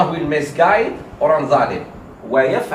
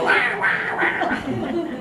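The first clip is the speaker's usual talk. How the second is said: high breathless squawk of a panicking bird; bird squawking in panic; 8 semitones higher, much slower than usual. Retold or imitated. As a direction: screech like the bird under the cat. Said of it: "He was".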